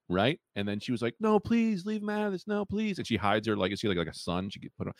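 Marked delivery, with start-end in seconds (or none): 1.2-3.0 mock pleading woman.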